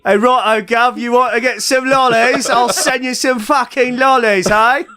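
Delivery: hammed-up Cockney English, geezer style; mock Cockney English. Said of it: "do Liam Neeson playing an Englishman".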